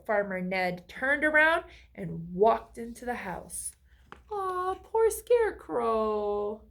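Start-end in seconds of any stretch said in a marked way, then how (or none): 4.3-6.6 slow, higher pitying aw; sympathetic. Real, not acted. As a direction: feel for the poor scarecrow. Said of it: "sympathy aside to listeners".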